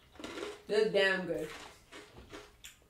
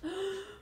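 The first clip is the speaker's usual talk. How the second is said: sharp gasp; 10 semitones higher, 9 dB quieter than usual. Real, not acted. Sour candy hits.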